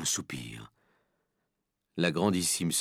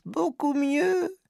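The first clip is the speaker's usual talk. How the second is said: very high, slow, squeaky promise; meek followers, drawn out and high-pitched; murmuring assembly; 3 dB louder, much slower than usual. A narrator's reading. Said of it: "murmura l'assistance".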